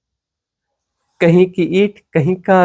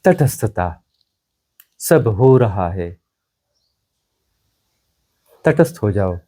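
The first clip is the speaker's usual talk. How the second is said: very low, unhurried, with long pauses; calm guidance; 10 semitones lower, 3 dB quieter than usual. Real, not acted. Teacher telling seekers to become neutral witnesses, calm guidance. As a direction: settle them into stillness in a low voice. Guiding listeners into detached witnessing.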